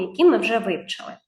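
normal talking voice

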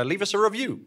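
usual speaking voice

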